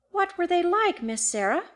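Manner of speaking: uneasy question, starting high; strange voice. A narrator's reading. Housekeeper asking Miss Sarah about the hunters, strange voice. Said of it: "asked in a strange voice".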